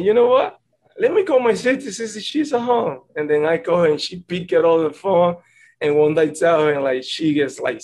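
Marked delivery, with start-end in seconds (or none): 0.0-3.0 pitched much higher, eager; sudden bright idea. Retold or imitated. Player retelling his thought to call his sister, excited. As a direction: lift it up high as the idea strikes.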